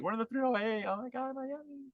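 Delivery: high, thin-voiced club girl squeal, kept soft; gushing party girl. Mocking clubbing women in Miami, high soft gush.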